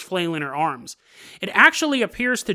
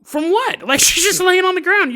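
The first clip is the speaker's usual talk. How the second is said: incredulous cops; 11 semitones higher, 6 dB louder than usual. Retold or imitated. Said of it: "they're like".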